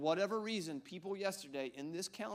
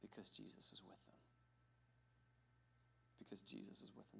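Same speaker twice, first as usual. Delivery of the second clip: soft emphasis; 4 semitones lower, 15 dB quieter, faster than usual. Shown as a demonstration.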